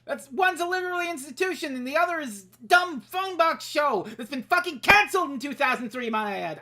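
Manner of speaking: indignant rant building to a loud, high peak; heated rant. Real, not acted. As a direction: start firm and climb into outraged shouting. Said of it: "I'm getting heated".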